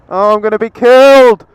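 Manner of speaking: hammed-up death shriek; mock terror. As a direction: wail like you're about to die. Hammed-up doom shout.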